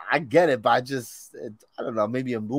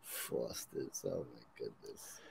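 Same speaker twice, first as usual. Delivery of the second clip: a hushed, breathy sigh of wonder at the name; whispered as if naming something sacred; mock reverent whisper; 23 dB quieter, slower than usual. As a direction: whisper it, much softer, in mock awe. Mock awe at the beer name.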